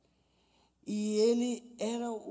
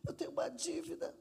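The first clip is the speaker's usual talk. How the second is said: small, higher, softer mock-tearful voice; retold tearful confession; 6 semitones higher, 6 dB quieter, faster than usual. Team member owning up to a debt, mock tearful. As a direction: lightly imitate someone confessing through tears. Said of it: "começou a chorar e falou assim".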